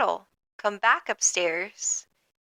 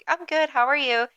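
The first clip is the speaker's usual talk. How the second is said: high, sing-song reply; imitating coworker; 7 semitones higher than usual. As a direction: echo the cheerful greeting back. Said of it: "And she's like".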